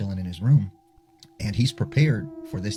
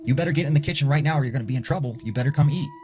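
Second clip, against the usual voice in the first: a mock stern mom calling from the kitchen, higher and fast; 6 semitones higher, faster than usual. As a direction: imitate a mom hollering him to dinner, quickly. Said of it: "he hears his mom saying".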